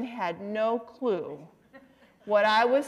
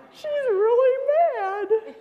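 very high, squealed imitation, louder; gleefully teasing dad; 13 semitones higher, 4 dB louder, slower than usual. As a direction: squeal with delight at the trouble caused. Dad delights in wife's anger.

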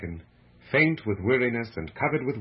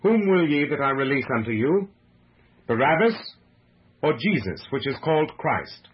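earnest, raised public appeal; higher, louder, urgent questioning; great earnestness; 4 semitones higher, 5 dB louder than usual. A narrator's reading. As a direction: put the question loudly and earnestly to a crowd. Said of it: "he said with great earnestness".

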